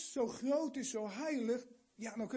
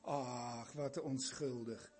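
low, soft doting coo; cooing over a baby; 10 semitones lower, 5 dB quieter, slower than usual. Retold or imitated. Preacher imitating parents cooing over their baby, soft and low. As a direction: melt over the sweet baby.

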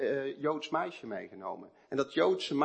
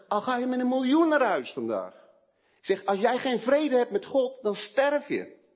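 higher, louder, slowing hard on "dan sterf je"; urgent warning; 9 semitones higher, 5 dB louder than usual. Retold or imitated. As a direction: press the warning home, last words dragged out. Urgent warning retold to businessman.

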